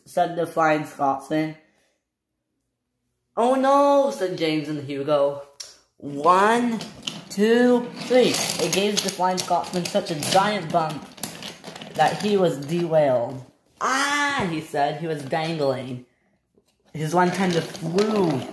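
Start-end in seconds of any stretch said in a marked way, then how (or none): 3.4-4.1 high, loud "oh no"; alarmed cry. Acted. James and Hugo in the story, alarmed high cry. Engines react to danger. 6.0-8.3 high, drawn-out count; counting off loudly. Acted. Engines counting before the bump, high calling count. 13.8-14.5 high-pitched "ah"; alarmed cry. Acted. Flying Scotsman left dangling, high alarmed cry.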